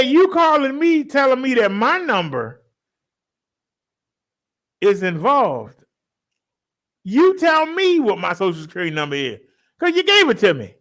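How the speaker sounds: mock outrage at the caller; fired up, calling out the scammer; indignant retort. Retelling his retort to scammer.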